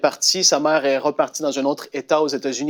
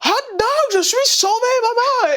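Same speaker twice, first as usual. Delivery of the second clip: very high, joyful shout to his mother; teen tells mother he is saved, ecstatic; ecstatic high cry. Retold or imitated. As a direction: squeal it out, bursting with joy. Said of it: "Il dit".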